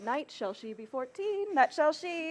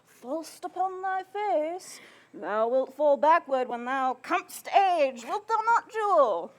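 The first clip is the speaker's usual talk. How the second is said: louder take-off of the teasing husband; husband's teasing voice; 3 semitones higher, 5 dB louder than usual. Acted. Nurse repeats her husband's joke to the mother, husband's teasing voice. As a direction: build loudly to the husband's punchline. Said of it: "quoth my husband".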